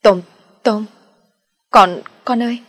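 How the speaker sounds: put-on broken, stuttering cry of a child's name; stammering in shock. A narrator's reading. Mother stammering over her wounded son, stunned. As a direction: stammer the name, loud and shaken. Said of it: "Chị An sốc quá mồm ú ớ".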